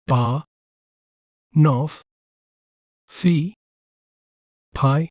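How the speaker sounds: "bar, nof, fee, pie" said slowly, one syllable at a time with pauses; broken into syllables. Shown as a demonstration. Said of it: "We may pronounce it as".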